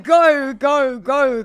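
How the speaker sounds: loud excited cheer of go go go; cheering someone on. Retold or imitated. Instructor on the bike cheering a rider to overtake, loud and excited. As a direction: urge a rival runner past you, loudly. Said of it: "I'd be like".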